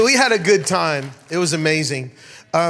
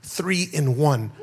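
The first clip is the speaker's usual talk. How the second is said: slow repeat; 3 semitones lower, slower than usual. Retold or imitated. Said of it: "he's like".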